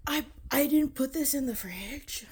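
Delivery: startled realization. Real, not acted. Speaker suddenly realizes this wasn't put in the fridge, startled. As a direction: blurt it out after a sharp gasp.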